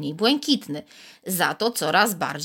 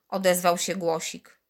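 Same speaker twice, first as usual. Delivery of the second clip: ordinary delivery, nothing marked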